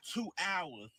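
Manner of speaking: ordinary delivery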